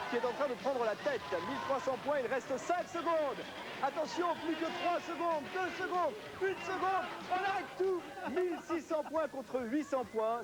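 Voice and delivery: high, excited game commentary; live play-by-play. Acted. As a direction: call the race as it happens, climbing.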